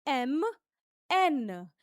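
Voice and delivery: "एम" and "एन" said with extra stress. Shown as a demonstration. Teacher showing French M and N, stressed. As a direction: press into "एम", "एन". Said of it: "थोड़ा सा stress लगाकर बोलेंगे एम और एन".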